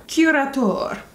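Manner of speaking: "curator" said as kee-RAH-tor, Italian-style; playful mock-fancy pronunciation. Shown as a demonstration. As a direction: roll out "curator" like a fancy foreign word.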